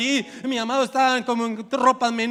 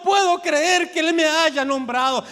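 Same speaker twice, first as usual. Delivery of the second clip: a touch of disbelieving awe, slow; voiced doubter; 8 semitones higher, 7 dB louder, slower than usual. Doubter tells preacher in disbelief. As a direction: play the unbelieving one, half-sung.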